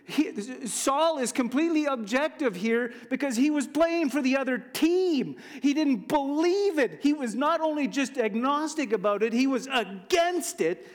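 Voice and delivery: high, forceful sermon delivery; fervent preacher, voice lifted and emphatic; impassioned preaching. Real, not acted. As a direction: proclaim it to the congregation with rising pitch.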